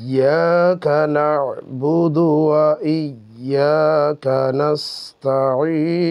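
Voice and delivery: slow Quranic recitation of the verse. Shown as a demonstration. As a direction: recite it slowly and reverently. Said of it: "তাহলে আমরা আবার দেখি".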